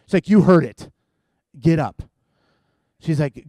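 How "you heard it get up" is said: loud, firm wife's command; mock stern order. Wife to husband faking sleep, loud stern order. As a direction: imitate an insistent wife, punched out.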